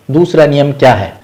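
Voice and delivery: ordinary delivery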